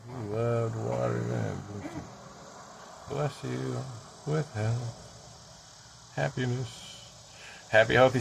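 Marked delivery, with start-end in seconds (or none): 0.1-2.0 hushed repeat. Real, not acted. Slow repeat of same question. 3.1-7.2 slow, hushed benediction; soft blessing. Real, not acted. Man blessing the listener with health and happiness, soft and slow.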